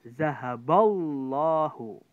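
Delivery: zahaballahu with a thick, heavy lam, slow and loud; heavy tafkhim lam. Shown as a demonstration. Teacher demonstrating the heavy lam to students, slow and loud. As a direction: boom out zahaballahu with a dark, full lam. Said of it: "tebalnya itu di situ".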